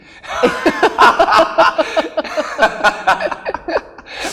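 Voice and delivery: long, loud burst of real laughter; big laugh. Real, not acted. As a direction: let the laugh roll out high and loud. Laughing at the joke.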